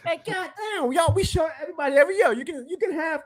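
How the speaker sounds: high-pitched heated rant, fast in places. Real, not acted. Fan ranting about cap hell, heated and high. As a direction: pour out the frustration. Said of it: "Rant over with".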